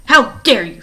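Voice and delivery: loud, indignant outburst; angry shout. A narrator's reading. Stefano shouting at Mister Poe after the crash, loud and indignant. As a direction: bellow it at the other driver. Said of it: "Stefano shouted".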